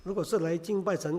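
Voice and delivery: usual speaking voice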